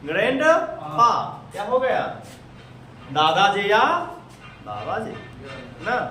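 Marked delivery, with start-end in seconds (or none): 0.0-1.1 slow spoken model word. Shown as a demonstration. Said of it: "केवल जाएंगे बोलेंगे grandpa".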